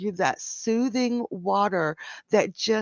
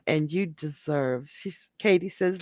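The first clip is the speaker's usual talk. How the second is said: usual speaking voice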